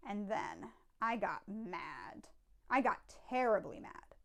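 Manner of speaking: ordinary delivery, nothing marked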